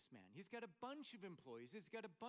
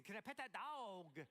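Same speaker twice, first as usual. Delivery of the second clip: put-on little girl voice; 3 semitones higher, 3 dB louder than usual. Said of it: "then she asks again".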